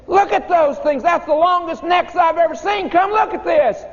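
put-on very high, shouted child's wonder; excited kids shouting. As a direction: holler it like an amazed kid. Kids calling teacher to window.

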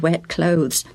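ordinary delivery

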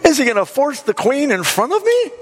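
very high, indignant question; really bothered. Acted. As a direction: play an outraged king, pitch way up. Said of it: "now he's really bothered".

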